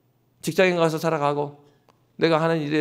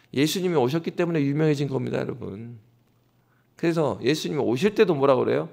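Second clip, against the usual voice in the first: ordinary talk, nothing marked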